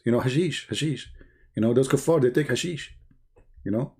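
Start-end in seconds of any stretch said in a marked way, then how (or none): none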